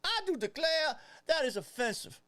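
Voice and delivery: honeyed southern lawyer drawl, high; a mock-offended Southern gentleman. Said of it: "You sound like an asshole with that southern drawl voice".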